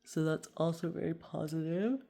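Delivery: talking through a yawn. Real, not acted. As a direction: yawn while speaking. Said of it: "Excuse my yawn".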